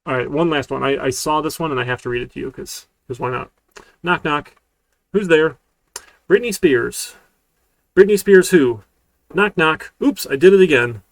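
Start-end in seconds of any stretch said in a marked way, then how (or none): 4.0-11.0 loud, high knock knock joke; hammy joke telling. Acted. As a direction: ham it up for the class.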